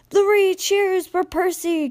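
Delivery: high, slow celebratory call. A narrator's reading. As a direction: lead a crowd in a cheer. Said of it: "called the teacher".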